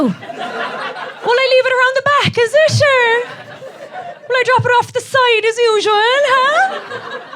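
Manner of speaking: put-on high, loud Cork accent; Cork milk deliverer. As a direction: call out cheerily in a hammed-up Cork lilt. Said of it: "sounds like you're delivering milk".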